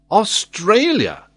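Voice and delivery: loud, high, drawn-out exclamation; vehement. A narrator's reading. Glenarvan disconcerting the old sailor, vehement. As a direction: burst out with full conviction. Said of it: "with such vehemence that the old sailor was somewhat disconcerted".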